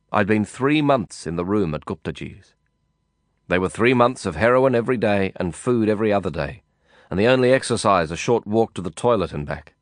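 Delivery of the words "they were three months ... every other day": ordinary delivery, nothing marked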